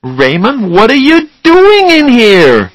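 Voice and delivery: slow, high, loud outraged shout. A narrator's reading. Charlie finds Raymond in his bedroom, shouting. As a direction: yell it, pitch soaring on "doing". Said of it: "he shouted".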